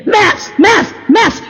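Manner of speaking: high shouted maps, over and over; demanding shout. Retold or imitated. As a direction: bang out each maps like a demand. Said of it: "I'm banging on the thing".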